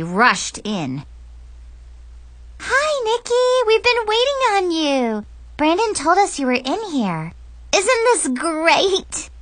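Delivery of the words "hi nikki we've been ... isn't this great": put-on very high, excited girl's squeal; high-pitched, drawn-out girlish thrill; breathless excitement. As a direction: greet a friend gushingly, stretching the key words. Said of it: "Chloe said breathlessly".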